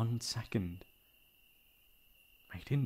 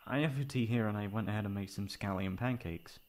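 ordinary talk, nothing marked

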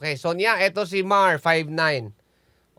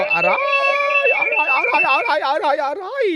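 ouch; 10 dB louder than usual. Real, not acted. Radio feedback hurts the host's ears.

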